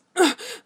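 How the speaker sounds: high, loud breathy groan; sudden wordless groan. A narrator's reading. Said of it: "Vegard groaned".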